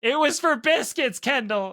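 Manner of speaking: slow, loud call to his wife; yelling to the next room. Real, not acted. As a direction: shout it out so she hears. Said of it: "Wait, yell back".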